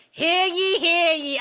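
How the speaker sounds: put-on Boston accent. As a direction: call out Hear ye like a Boston court bailiff. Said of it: "the one for the Karen Reed trial is like".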